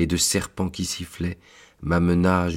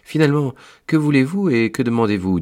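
impatient angel; 8 semitones higher, 6 dB louder, faster than usual. Acted. Angel challenges the visiting priest.

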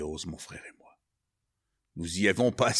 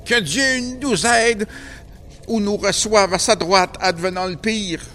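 fervent prayer; 12 semitones higher, 11 dB louder than usual. Acted. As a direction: cry the prayer out to God. Brother prays aloud in danger. (prière fervente, lente et forte)

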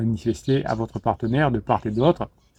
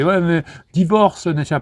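mock friend's urging, higher and drawn out; slow, pitched-up push from an exasperated friend; 5 semitones higher than usual. Fed-up friends to the listener about a breakup, mock urging. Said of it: "qui vous disaient".

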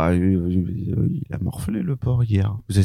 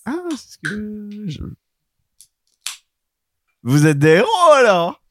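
surprised; 12 semitones higher, 4 dB louder than usual. Acted. Merchant surprised, high.

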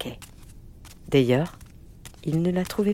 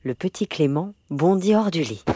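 plain reading voice

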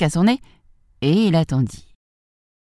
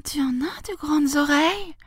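put-on very high child voice; high-pitched childish wonder; astonished little girl; 12 semitones higher than usual. A narrator's reading. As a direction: marvel in a little-girl falsetto. Girl marvels at disguised wolf.

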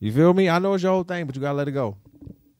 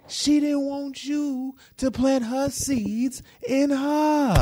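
high, slow mock-comforting coo; mock soothing; 7 semitones higher than usual. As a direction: pat him on the head with your voice. Mock-consoling [name].

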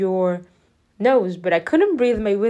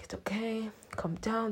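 much softer, as if to oneself; hushed self-soothing; 14 dB quieter than usual. Retold or imitated. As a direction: quietly talk yourself down. Calming self before dentist.

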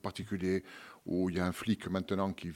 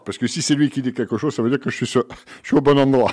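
much louder, higher, laughing; loud, excited, laughing explanation; 5 semitones higher, 14 dB louder than usual. Real, not acted. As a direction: burst out loudly with amusement. Guest laughing, explaining his joke.

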